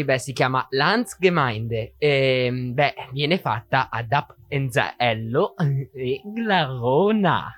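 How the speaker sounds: slow put-on German accent in Italian; drawn-out, exaggerated German accent in Italian. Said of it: "volevo fare un accento tedesco".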